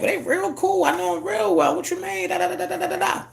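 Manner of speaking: high sing-song mock of own naive answer; high mocking self-imitation. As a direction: mimic your clueless younger self, squeaky and breezy.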